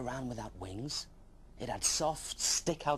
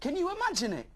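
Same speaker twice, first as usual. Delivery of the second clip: high, louder astonished question; amazed; 4 dB louder than usual. Acted. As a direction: gasp at the idea. Dragon amazed.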